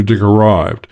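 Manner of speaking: ordinary delivery, nothing marked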